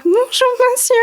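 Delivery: high, tiny polite bonjour; sweet little voice. Retold or imitated. Greeting the phone repairman in a tiny high voice. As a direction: play small and innocent at the counter.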